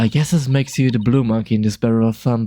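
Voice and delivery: normal talking voice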